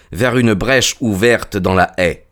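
ordinary delivery, nothing marked

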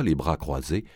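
ordinary delivery, nothing marked

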